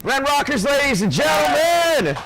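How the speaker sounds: high, slow ringing announcement; announcer shout. Real, not acted. Commentator hyping the winner to the crowd, loud announcer shout. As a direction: belt the winner's name out to the room.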